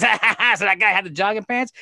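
high, sneering fake laugh; a smug boss gloating, pitched high; mocking manager. Mimicking the boss who mocks his jogging-pants customer, high and sneering.

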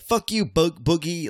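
jokingly hostile jab at absent cohost Boogie; mock hostile jab. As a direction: voice the guest's pretend grudge. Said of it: "You're like".

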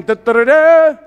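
high, loud "da-da-da-da" fanfare; rising mock trumpet blast; imitated trumpet fanfare. Shown as a demonstration. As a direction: sound a trumpet call with the voice. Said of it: "the trumpet is playing".

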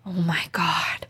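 hushed, low retold gasp of horror; horrified whisper. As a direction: whisper it like an aghast onlooker. Coworker's horrified reaction retold.

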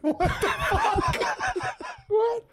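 high squeal of laughing disbelief. Real, not acted. Hearing the orange head punchline, laughing in high disbelief. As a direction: cracks up, pitch shooting way up.

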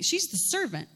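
ordinary delivery

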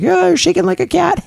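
high teasing imitation of laughing men. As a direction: tease her like the guys did, giggling. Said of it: "And they were laughing".

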